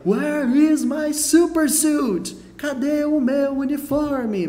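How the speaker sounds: high, slow, frantic superhero demand; voz do gelado. Retold or imitated. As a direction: whine for the lost super suit like Frozone. Imita o gelado dos Incríveis. (voz aguda e lenta do Gelado procurando o uniforme)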